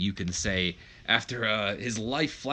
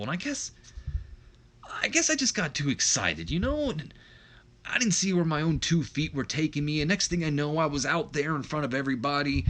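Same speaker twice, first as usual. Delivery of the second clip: pleading excuse; 5 semitones higher than usual. Acted. Acted excuse, begging brother's forgiveness.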